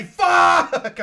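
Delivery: loud, high shout of frustration; a real angry yelp at the dice; real yell. Real, not acted. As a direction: blurt it out at the bad roll. Rolled a one, yells.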